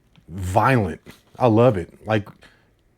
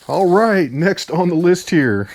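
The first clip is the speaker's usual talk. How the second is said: normal talking voice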